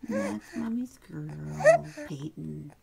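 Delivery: slow, loud sing-song cooing; squeaky baby talk. Acted. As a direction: gush over the baby.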